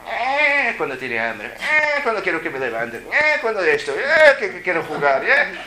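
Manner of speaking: put-on high, loud bossy baby whining eh; demanding baby. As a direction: boss everyone like a tiny dictator. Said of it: "era un dictador". (bebé tirano exigente, agudo y fuerte)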